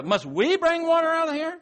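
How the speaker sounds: mock the crowd with exasperation; exasperated Moses. Moses snaps at the congregation, exasperated. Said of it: "He said".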